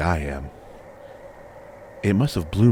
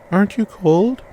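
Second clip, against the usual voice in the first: put-on high, louder childlike question; young girl voice; 14 semitones higher, 7 dB louder than usual. A narrator's reading. Girl asks the naked stranger about the cold, high childlike voice. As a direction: ask it like a concerned little girl.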